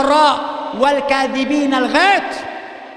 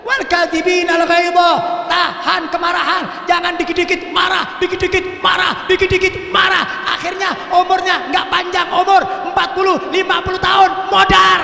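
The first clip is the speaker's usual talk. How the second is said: high fervent preaching; 5 semitones higher than usual. Real, not acted. Preacher warning wives not to yell at husbands.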